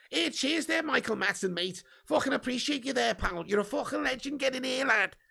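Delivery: put-on Scouse accent. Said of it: "Great Scouse accent".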